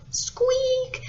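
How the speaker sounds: very high drawn squeak; voiced imitation of a high squeak; squeaking door. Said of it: "And the door went".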